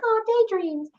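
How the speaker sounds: high-pitched dreamy coo; mock dreamy. Cooing over the baby making app, mock dreamy. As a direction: float it up high like a swooning daydreamer.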